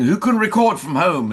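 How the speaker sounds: high, loud call to the whole room. Retold or imitated. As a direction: call out the question to everyone. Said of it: "producers were saying".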